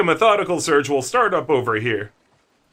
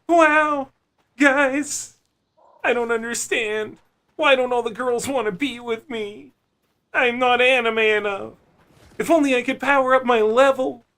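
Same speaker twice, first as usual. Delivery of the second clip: high, whiny anime protagonist voice; overacted anime dub; 10 semitones higher than usual. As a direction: play a sulky anime hero, pitched up. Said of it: "anime character voice ... main character experiencing ennui".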